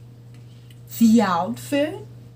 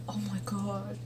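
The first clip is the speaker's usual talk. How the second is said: swooning whisper; 2 semitones lower, 12 dB quieter than usual. Real, not acted. Swooning fan watching idol.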